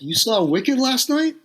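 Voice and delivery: high, slow disbelieving question; startled, drawn-out disbelief; surprised. Retold or imitated. Drummer replying to Phil's news, high surprised disbelief.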